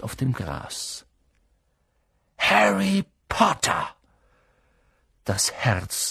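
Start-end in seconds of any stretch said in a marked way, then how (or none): 2.4-3.9 high-pitched, aghast call of a name; stern professor. A narrator's reading. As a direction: call out the pupil's name in horror. Horrified professor confronts Harry. (entsetzte, hohe Lehrerinnenstimme)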